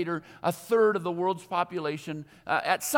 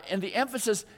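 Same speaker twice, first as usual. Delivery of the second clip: normal talking voice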